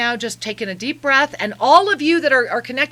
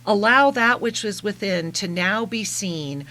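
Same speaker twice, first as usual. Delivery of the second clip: channeled message; 4 semitones lower than usual. Acted.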